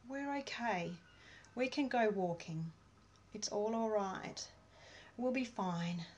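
soft, slow reassuring self-talk fading down; quiet calming affirmation; calm release. Shown as a demonstration. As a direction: soothe yourself gently. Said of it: "there'll be a bit of a release".

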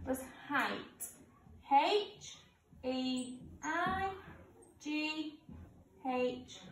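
spelling out letters one by one; slow school dictation. Acted. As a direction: spell each letter clearly for children. Teacher spells out the answers.

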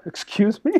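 high-pitched retold disbelief; incredulous. Replying to a job seeker once kicked out, incredulous. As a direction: pitch it way up, taken aback.

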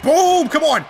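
loud, high victory shout; excited yell. Real, not acted. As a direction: celebrate the hit. Landing finishing move.

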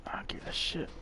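breathy under-breath grumble; whispered mutter. Real, not acted. Muttered aside while playing.

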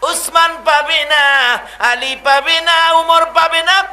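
high, loud chanted refusals building to a shout; impassioned proclamation. Acted. Tearful Prophet telling companions who gets his cloak, impassioned shout. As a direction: hammer each name out with long held endings.

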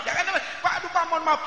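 giggling plea not to be offended; laughing apology. Real, not acted. Minta maaf sambil tertawa. (minta maaf sambil tertawa)

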